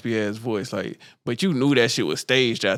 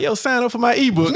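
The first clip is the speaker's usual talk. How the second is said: hustler guru voice; 11 semitones higher, 3 dB louder than usual. Retold or imitated. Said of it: "Then he be like".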